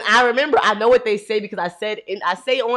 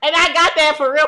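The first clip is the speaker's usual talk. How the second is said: laughing, high, slow and loud; laughing excitement; 7 semitones higher, 4 dB louder, slower than usual. Real, not acted. She laughs about being ready, needing only a dress, excited.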